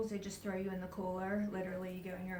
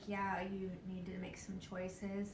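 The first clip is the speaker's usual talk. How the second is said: slow whispered guidance; hushed whisper; 6 dB quieter, slower than usual. Retold or imitated. The guide telling her to choose, in a slow whisper. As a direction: breathe it out slowly, like a quiet verdict.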